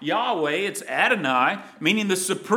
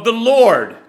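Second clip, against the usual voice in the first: the Lord much louder, ringing out; a loud, climactic cry of the Lord; booming proclamation; 5 semitones higher, 11 dB louder than usual. Acted. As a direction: let the Lord boom across the hall. King proclaims the decree.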